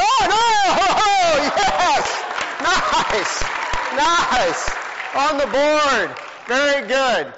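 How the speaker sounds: high, loud delighted praise with a laugh; excited cheering. Real, not acted. Youth leader cheering a team's correct Bible answer, excited. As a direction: celebrate the right answer out loud.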